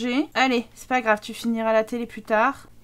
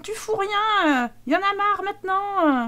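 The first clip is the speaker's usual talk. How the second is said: angry wife; 7 semitones higher than usual. Acted. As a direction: tell him off for doing nothing. Voicing wife in quarrel.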